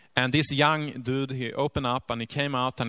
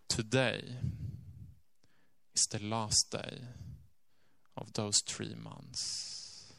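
much softer, slowing to near silence; hushed grave reveal; 2 semitones lower, 9 dB quieter, slower than usual. Retold or imitated. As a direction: let the last words fall away. Lady reveals final day.

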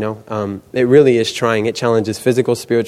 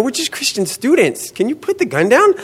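very high, squeaky pleading; mock nervous plea; 13 semitones higher than usual. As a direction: plead comically with an armed man. Acted plea to man with gun.

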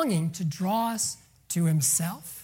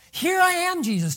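mock shout; 8 semitones higher, 5 dB louder than usual. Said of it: "wanting to whisper it. No, wanting to shout it".

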